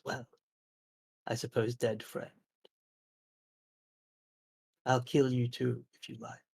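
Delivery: soft, unhurried creature threat, fading almost to nothing; taunting forest creature. Acted. Wooden killer to the dead paladin's friends, soft menace. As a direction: threaten softly, pausing, letting the last words die away. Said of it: "elvish paladin and says".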